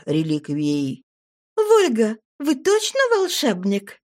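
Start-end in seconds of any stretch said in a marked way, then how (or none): 1.6-4.0 teasing smirk. A narrator's reading. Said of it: "усмехнулась я".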